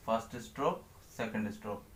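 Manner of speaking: soft, low counting of each stroke; quiet stroke count. Shown as a demonstration. Teacher counts strokes softly while writing. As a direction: murmur the count while drawing.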